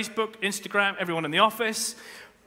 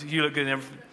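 coached answer; 2 semitones lower, much faster than usual. Shown as a demonstration. Preacher coaching men on the answer, smoothly. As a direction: deliver "You look good in everything" as the practised line. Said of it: "so what is the right response".

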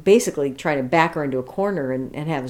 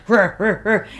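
chainsaw buzz; 3 semitones higher, 3 dB louder than usual. Retold or imitated. Echoing the growl imitation.

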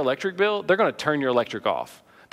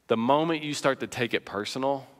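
usual speaking voice

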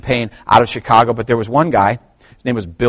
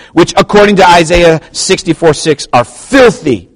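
louder, higher declaimed reading; forceful preaching; 8 semitones higher, 9 dB louder than usual. Acted. Preacher reads a quote on sin to the church, forceful preaching.

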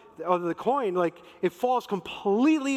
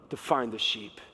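voicing God; 7 semitones lower than usual. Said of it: "But God's like".

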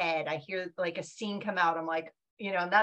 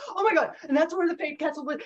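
higher and louder, thrilled; excited; 8 semitones higher, 5 dB louder than usual. Retold or imitated. Author recalling the Budapest trip to podcast hosts, excited and higher. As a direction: relive the rush of seeing the castle. Recalls seeing the Fae Castle.

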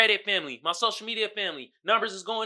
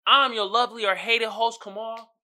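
usual speaking voice

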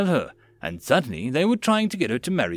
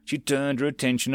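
plain reading voice

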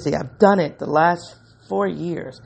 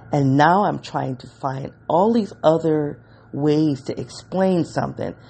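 ordinary talk, nothing marked

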